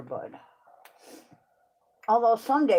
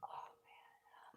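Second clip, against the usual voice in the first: whispering; 26 dB quieter than usual. Real, not acted. Back pain complaint.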